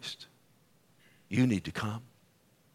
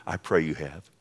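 usual speaking voice

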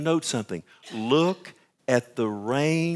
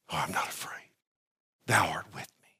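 soft, much lower whisper; 10 semitones lower, 9 dB quieter than usual. Acted. Preacher quoting the psalm to mourners in a low whisper. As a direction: let it fall to a hush.

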